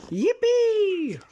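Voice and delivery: high, loud yippee; cheer. Acted. Cheering the rescue of Turtle Boy, delighted. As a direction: throw it up in delight.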